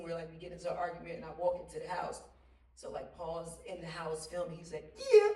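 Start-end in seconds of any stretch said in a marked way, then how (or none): 5.0-5.3 much higher, loud beaming praise; loud, high delighted praise from an uncle figure; proud uncle. Retold or imitated. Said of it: "It was like a proud uncle".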